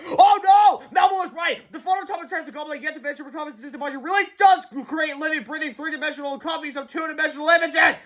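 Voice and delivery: high, loud, breathless alarm; panicked cry. A narrator's reading. Harold crying out to George in panic, high and loud. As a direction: blurt the jargon in a panic. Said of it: "Harold cried convolutedly".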